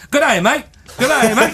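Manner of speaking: high squeaky Australian dog voice; dog saying good day mate. Acted. Dog trying to warn his owner, high yappy voice. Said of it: "He's like".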